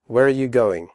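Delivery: fast, are blended into you; quick natural question; quickly. Shown as a demonstration. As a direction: run it together quickly. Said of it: "first slowly and then quickly".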